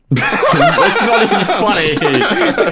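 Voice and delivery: slow, high and loud through laughter; laughing too hard to mean it. Real, not acted. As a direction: protest while cracking up. Laughing while protesting joke.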